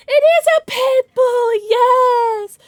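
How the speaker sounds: drawn-out high cooing shout; gushing pet talk. Real, not acted. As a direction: gush over the dog at full voice. Gushing over the dog.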